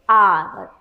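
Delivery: "Hour" low and drawn out; correct pronunciation demo. Shown as a demonstration. Teacher closing the drill, correct pronunciation demo. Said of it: "the right pronunciation is".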